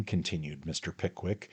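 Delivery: plain reading voice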